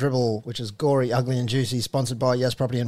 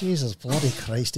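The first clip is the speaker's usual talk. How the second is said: normal talking voice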